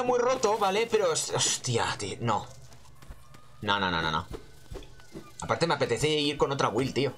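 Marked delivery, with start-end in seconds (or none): none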